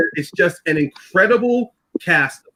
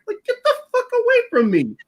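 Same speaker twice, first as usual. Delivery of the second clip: much higher, squeaky get-away-from-me; incredulous brush-off. Retold or imitated. LA local waving off a self-declared devil, incredulous and high. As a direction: recoil from a weirdo claiming to be the devil. Imagined LA listener brushing him off.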